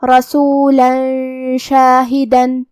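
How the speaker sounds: رسولا then شاہدن, the final tanween of شاہدن hidden with a held nasal ghunna, slowly; tajweed demo with nasal ghunna. Shown as a demonstration. Teacher shows students the ikhfa rule, slow careful demo. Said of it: "یہ حکم کو ادا کریں گے".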